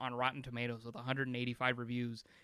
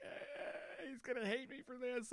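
higher, quiet, nervous voice; fretting quietly, higher pitch; worried quote; 11 semitones higher, 12 dB quieter, slower than usual. Retold or imitated. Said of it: "and I was like".

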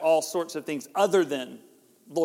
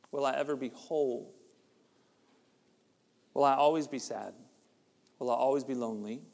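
inner doubts; 5 semitones lower, 4 dB quieter than usual. Retold or imitated. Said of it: "questions that chase us".

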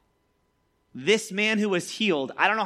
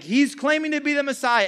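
high-pitched indignant accusation; mock outrage; 9 semitones higher, 4 dB louder than usual. Pharisees objecting to Jesus's claim, mock outrage. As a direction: accuse him as if scandalized.

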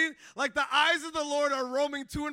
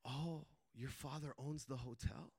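low, soft, slow retold question; hushed question; 8 semitones lower, 12 dB quieter than usual. Guard asking the visitor about his father, hushed. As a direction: voice the guard's quiet, slow query.

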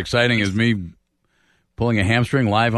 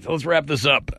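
ordinary talk

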